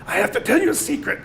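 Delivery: very high, strained croak; parched-throat croak. A narrator's reading. Boy calling his twin over for help, croaking high. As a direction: squeeze it out through a dry throat. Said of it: "I croaked from a parched throat".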